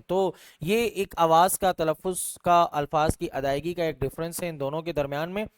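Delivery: ordinary delivery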